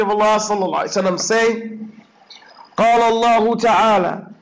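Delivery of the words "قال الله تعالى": fast, loud Arabic recitation. Acted. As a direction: recite the hadith briskly and firmly.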